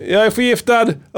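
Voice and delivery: high hammed-up wailing; high put-on scream of agony; mock distress. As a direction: cry out like a fake poisoning victim. Acting out poisoned screaming man.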